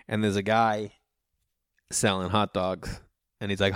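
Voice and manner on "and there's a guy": ordinary talk, nothing marked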